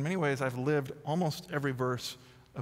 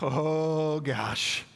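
dread. Retold or imitated. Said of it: "And I was like".

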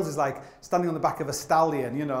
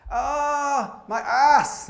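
pained yelp; 9 semitones higher, 4 dB louder, much slower than usual. Retold or imitated. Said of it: "he's like".

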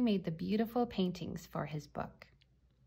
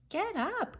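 put-on high-pitched child's call; 12 semitones higher than usual. A narrator's reading. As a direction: call up to sleeping friends. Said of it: "called Mac".

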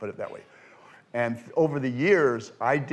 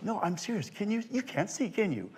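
high, soft mock questioning; hushed insistence; 7 semitones higher, 8 dB quieter than usual. Actress doubting the magician's blindness, hushed and insistent. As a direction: imitate quiet, puzzled pressing. Actress presses him again.

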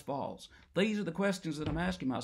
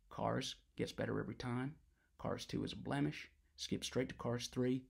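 calm and quiet; 6 semitones lower, 10 dB quieter than usual. Acted. Soft calm after the outburst.

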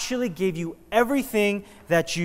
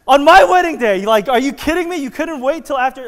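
much higher, loud mock-outraged yell with a laugh; hammed-up furious high shout; pissed off; 10 semitones higher, 14 dB louder than usual. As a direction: rant like a bride whose wedding got flooded. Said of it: "I would get pissed".

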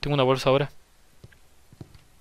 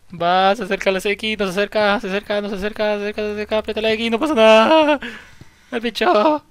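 high, loud frantic yelling; screaming in real panic at the monster; real terrified shouting; panicked; 7 semitones higher, 6 dB louder than usual. Real, not acted. Panicked chase in horror game.

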